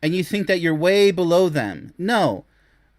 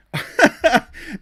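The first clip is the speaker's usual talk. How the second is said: a loud, high burst of scornful laughter; mocking laugh; 9 semitones higher, 5 dB louder than usual. Real, not acted. Scorning the show's male contestants, loud mocking laugh. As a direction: laugh right at the guy.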